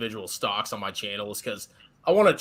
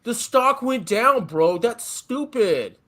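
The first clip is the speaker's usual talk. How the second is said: mock outrage; 8 semitones higher, 5 dB louder than usual. Mocking Jay's stock buy.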